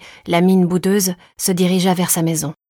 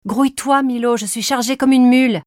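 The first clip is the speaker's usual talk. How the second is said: raised, higher-pitched hurrying voice; exasperated nagging, pitched up; impatient mother; 6 semitones higher, 3 dB louder than usual. A narrator's reading. Laden mother to her dawdling son, impatient.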